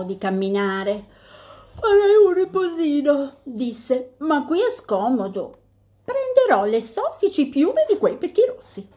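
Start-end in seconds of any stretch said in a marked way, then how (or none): 1.7-8.9 higher, lazy bear voice; a spoiled bear wanting comfort; sleepy bear. Acted. Bear wants a nap.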